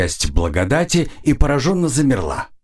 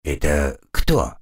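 low slow insolent voice; rude small creature; 8 semitones lower, slower than usual. A narrator's reading. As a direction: ask it rudely, pointing. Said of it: "нагло спросил".

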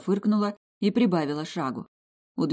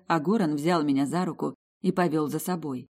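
plain reading voice

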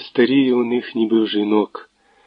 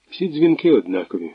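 plain reading voice

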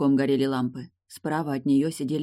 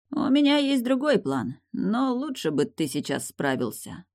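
high-pitched mysterious opening, easing down; hint cryptically at a secret; with an enigmatic air; 8 semitones higher than usual. A narrator's reading. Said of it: "загадочно произнёс Фиери".

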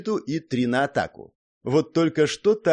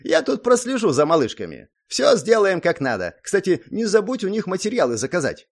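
higher, louder, self-satisfied voice; pleased schemer; 8 semitones higher, 4 dB louder than usual. A narrator's reading. Zak promising the hero he'll watch the little ones, pleased. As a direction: pitch it up with smug relish. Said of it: "довольно потирая руки, произнёс он".

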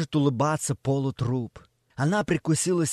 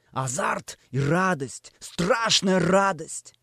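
ordinary delivery

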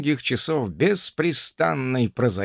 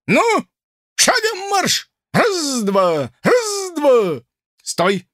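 shouting drill sergeant; 7 semitones higher than usual. Acted. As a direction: bark the count like a drill sergeant. Officer drilling cadet, commands.

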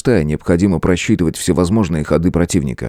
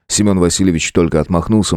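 ordinary delivery, nothing marked

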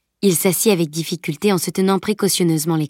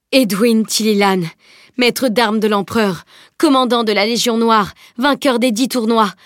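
higher, emphatic roll of titles; reverent proclamation; 4 semitones higher, 3 dB louder than usual. A narrator's reading. Knight hails the emperor's master-at-arms, reverent proclamation. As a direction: proclaim each title like a herald.

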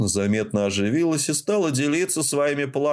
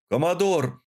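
высокий окрик вдогонку; крикни, догоняя командира; 5 semitones higher than usual. Acted. Парень окликает командира. (громко окликает коммодора)

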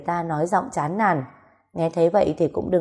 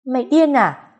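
high, louder incredulous question; indignant outburst; 7 semitones higher, 6 dB louder than usual. A narrator's reading. Woman answering his possessive demand, indignant outburst. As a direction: fire it back in disbelief.

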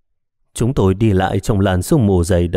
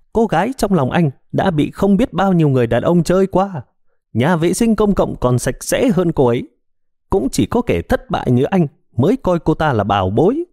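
higher, jeering ghostly woman; mocking woman's voice; 7 semitones higher than usual. A narrator's reading. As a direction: taunt him with contempt. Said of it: "Giọng nói lại tiếp tục giễu cợt". (giọng phụ nữ giễu cợt, cao giọng)

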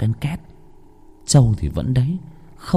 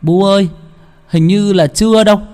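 put-on higher, louder young voice answering; uncle answering; 10 semitones higher, 5 dB louder than usual. Uncle tells grandma the boy is not back, unsure young voice.

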